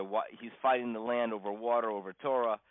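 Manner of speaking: normal talking voice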